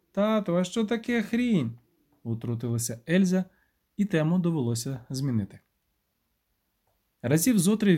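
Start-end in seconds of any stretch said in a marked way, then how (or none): 0.1-1.8 put-on high, slow little girl's question; child's voice. A narrator's reading. Elza butts in to ask her dad, high child's voice.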